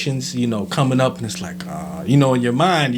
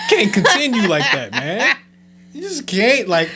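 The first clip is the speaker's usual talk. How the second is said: laughing; 10 semitones higher than usual. Real, not acted. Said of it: "laughing while describing".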